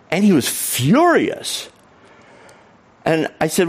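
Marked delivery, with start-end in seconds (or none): 0.1-1.7 FURIOUS stretched out slowly, high; emphatic telling. Retold or imitated. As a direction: lean long and high on "furious". Teller stresses the man's fury.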